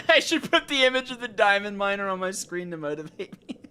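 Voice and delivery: high, loud, through laughter; laughing while talking. Real, not acted. Streamer amused by a motivation idea, laughing as they talk.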